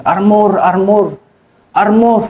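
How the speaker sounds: high mock shout of Armor, held back in volume; retold shout. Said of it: "na sigaw ng armor".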